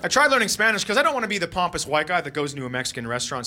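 usual speaking voice

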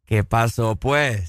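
low Colombian lilt; low-pitched Colombian imitation; Colombian accent. Shown as a demonstration. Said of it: "hacelo vos y yo lo repito".

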